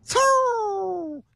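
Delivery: 高音拖长、逐渐下滑的飞驰声; 拟声学车飞快掠过; 模仿汽车“嗖”声. Acted. 模仿轿车飞驰而来. (拖长尾音模仿疾驰)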